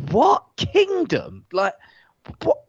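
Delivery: mock outrage at a silly word; incredulous disbelief. Scoffing at the presenter's kingdom talk, squeaky disbelief. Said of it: "You're like".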